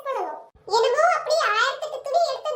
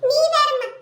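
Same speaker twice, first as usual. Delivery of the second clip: loud brushing-off; dismissive; 3 semitones higher, 8 dB louder than usual. Acted. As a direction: wave her question away loudly. Dismissing mother's question. (அலட்சியமான உரத்த மறுப்பு)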